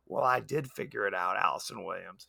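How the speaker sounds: lightly voiced killer doll, low and quiet; cold AI taunting her creator, low and hushed; menacing robot voice. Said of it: "I'm paraphrasing".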